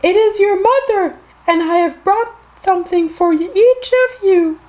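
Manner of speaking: very high, put-on sing-song mother voice; wolf posing as mother. A narrator's reading. Wolf at the door to the seven kids, high pretend-mother voice. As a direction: coax in a fake high mother's voice. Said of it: "he said".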